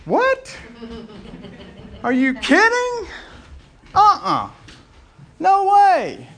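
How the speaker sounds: high, loud shocked protest; John stunned that Jesus asks him; shocked disbelief. Retold or imitated. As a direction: recoil in disbelief. John refusing to baptize Jesus.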